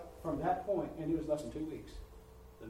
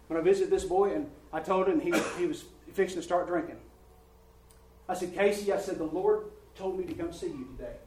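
preaching at full force; 8 dB louder than usual. Acted. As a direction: drive it home to the congregation. Preaching a testimony loudly.

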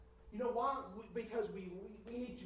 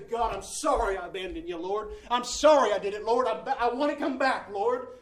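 loud repentant prayer; 4 semitones higher, 15 dB louder than usual. Retold or imitated.

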